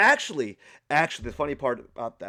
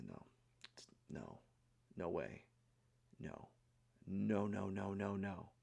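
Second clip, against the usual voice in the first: near-silent string of nos, much softer; hushed refusal; 5 semitones lower, 16 dB quieter than usual. Retold or imitated. Staring at the shower valve, whispering no over and over. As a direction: barely breathe the nos in dread.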